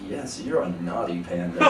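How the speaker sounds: a low laughing coax to the panda; low mock encouragement. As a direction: murmur low like egging on a panda. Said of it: "being like".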